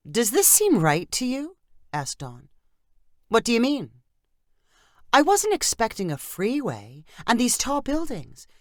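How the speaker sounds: unsure where they've landed; puzzled. Acted. Dawn puzzled.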